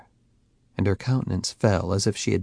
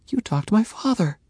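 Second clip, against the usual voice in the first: put-on young woman voice; 12 semitones higher than usual. Faye realizes.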